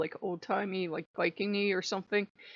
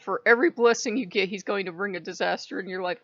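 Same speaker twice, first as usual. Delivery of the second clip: usual speaking voice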